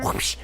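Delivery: short imitated whoosh; dab sound. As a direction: make a quick whoosh for a dab. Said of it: "That's the dab sound".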